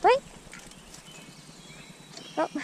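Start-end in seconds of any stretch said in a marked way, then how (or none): none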